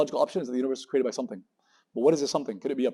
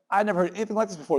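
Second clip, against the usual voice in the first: high, excited judge voice; mock amazement, pitched up; amazed judge; 7 semitones higher than usual. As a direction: gush like a stunned judge. Judge amazed in rap battle story.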